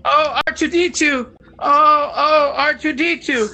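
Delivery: high, fretful droid impression; C three P O. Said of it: "C three P O impression".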